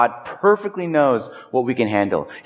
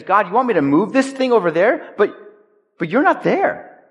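mock whine; 7 semitones higher than usual. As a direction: whine at God like a sulky kid. Whining complaint voiced to God.

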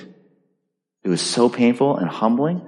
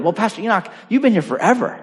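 higher, lightly voiced churchgoer blurting it out; blurted remark; 7 semitones higher, faster than usual. Church member blurting to the pastor, lightly mimicked. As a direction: toss it off casually, a bit high. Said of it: "they blurted".

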